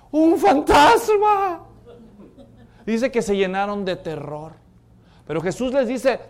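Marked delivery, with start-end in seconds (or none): 0.1-1.6 slow, high, loud cry of fright; a high drawn-out shriek of "¡Un fantasma!"; terrified shout. Acted. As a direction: scream it out at a ghost on the water. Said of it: "todos al verlo gritan".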